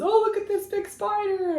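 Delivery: high-pitched mock-teasing quote; imitated husband, pitched well up. Husband teasing her, voiced.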